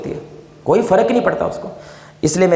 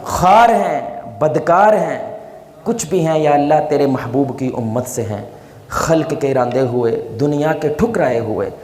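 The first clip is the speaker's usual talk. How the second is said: fervent prayer; 5 semitones lower than usual. Acted. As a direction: beg God humbly for help. Closing supplication prayer.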